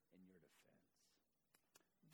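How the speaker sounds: slow unvoiced whisper. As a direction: let it fade to a whisper. Hushed scripture ending.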